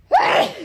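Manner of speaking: loud, very high burst of a sneeze; sneezing. Real, not acted. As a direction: sneeze hard mid-sentence. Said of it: "I told you I was gonna sneeze".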